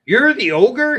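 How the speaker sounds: high mocking jeer. Goat jeers at the ogre under the bridge, high mocking voice. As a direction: sneer down at the little ogre. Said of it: "he jeered".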